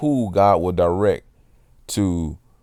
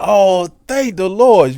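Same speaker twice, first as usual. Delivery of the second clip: much higher, louder and slow, imitating a thrilled lady; overjoyed thanks; 12 semitones higher, 6 dB louder, slower than usual. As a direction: stretch it out like she can't believe the gift. Lady overjoyed at free water.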